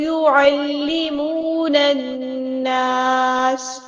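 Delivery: slow melodic tajweed chant; Quran recitation. Shown as a demonstration. Teacher recites the full verse for students, chanting. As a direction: intone the whole verse like a qari.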